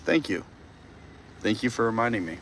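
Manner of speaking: ordinary talk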